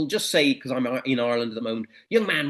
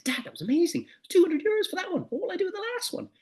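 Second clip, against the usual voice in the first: put-on much higher, rushing boy's voice; much higher, excited teenage son; thrilled son. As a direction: squeal with delight to dad.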